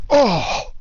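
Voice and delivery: startled shout into laughter; surprised laugh. Real, not acted. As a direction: caught off guard, laugh. Beer sprays onto GoPro.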